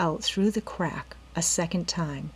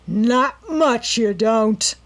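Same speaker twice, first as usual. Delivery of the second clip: slow, louder menacing snarl; 4 semitones higher, 7 dB louder, much slower than usual. A narrator's reading. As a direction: growl it as you grab her. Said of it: "he snarled".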